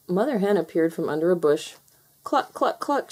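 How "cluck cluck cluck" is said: high, soothing hen clucks; kindly clucking. A narrator's reading. Mother Hen to crying little foxes, kindly clucking. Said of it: "she said kindly".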